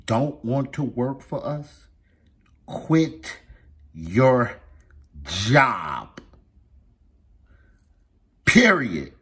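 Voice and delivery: loud and punchy; emphatic. Real, not acted. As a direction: hammer each word home. Ranting about public employees.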